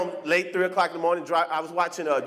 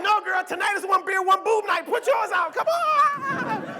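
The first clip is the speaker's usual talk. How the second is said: put-on tipsy girlfriend voice; 10 semitones higher, slower than usual. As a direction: urge her friend to join, laughing. Drunk friend urging.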